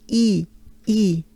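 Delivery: "E" as a pure ee with lips spread wide; banana-smile E. Shown as a demonstration. Teacher showing a vowel to learners, banana-smile ee. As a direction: say "E" as ee through a big smile. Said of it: "a banana smile".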